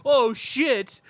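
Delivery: loud, high mock dismay; mock oh shit. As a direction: blurt it out in hammed-up panic. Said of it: "he was like".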